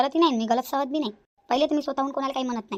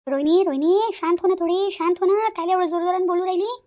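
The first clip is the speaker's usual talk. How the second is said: calling to calm; 5 semitones higher, 5 dB louder than usual. Acted. Elder calls Rohini to calm down.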